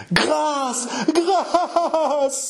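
high, drawn-out theatrical sobs; sobbing plea. Acted. Martyred wife begging for mercy, sobbing plea. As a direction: break the second word into wailing sobs. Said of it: "sanglota l'épouse martyre".

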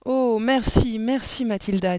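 high, loud burst of thanks; delighted. A narrator's reading. Miss Candy thanks Mathilda for the quatrain, delighted. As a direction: thank her with open delight. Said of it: "dit-elle ravie".

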